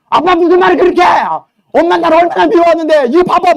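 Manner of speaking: put-on high, loud indignant girl; protesting child. Daughter protesting to her dad and showing her bruises, high indignant.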